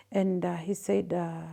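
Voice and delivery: ordinary talk, nothing marked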